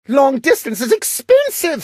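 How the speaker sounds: cried out. A narrator's reading. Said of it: "sister cried".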